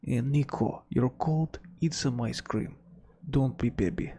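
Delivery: put-on Russian accent, brusque advice; mock Russian accent. Russian telling Nico to toughen up, in a mock Russian accent. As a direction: scold lightly in a hammed-up Russian accent. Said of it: "the most Russian thing".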